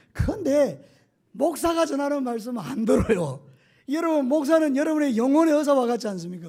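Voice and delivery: loud, rising preacher's cry; preaching at full force. Acted. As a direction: press it on the congregation with full force. Preacher raising voice on congregation. (힘차게 외치는 설교)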